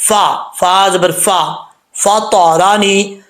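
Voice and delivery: फा stretched slowly, lips rounded; slow careful recitation. Shown as a demonstration. Teacher reciting the word slowly for students. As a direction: recite फा तौरानी slowly and deliberately. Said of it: "होंठ गोल करके".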